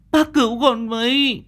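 put-on very high child's wail for help; frightened little boy. A narrator's reading. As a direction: plead desperately in a small boy's voice.

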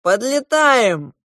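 loud, high, drawn-out call; announcing. A narrator's reading. Goose pilot to passengers, announcing the approach. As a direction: sing out to the passengers. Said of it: "оповестил злой гусь".

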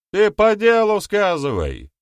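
high, loud, drawn-out yell; heckling shout. A narrator's reading. Someone in the back row heckles the speaker, shouting. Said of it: "выкрикнул кто-то из заднего ряда".

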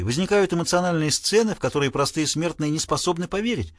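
plain reading voice

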